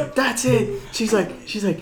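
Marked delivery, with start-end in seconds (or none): 0.2-0.8 high-pitched imitation of a fed-up instructor; mock outburst, not shouted; losing it. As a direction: act out her snapping point. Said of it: "she was losing it".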